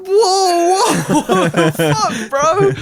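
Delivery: high, loud, laughing shock; startled yelp of disbelief through laughter; shocked whoa. Retold or imitated. Camper finds a stranger under his tent, laughing shocked yell.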